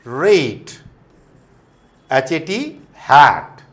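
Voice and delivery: "rate" with long "ei", called out loud; loud drill. Shown as a demonstration. Teacher drilling class on letter A, loud words. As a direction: hit each model word. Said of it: "the A will become A".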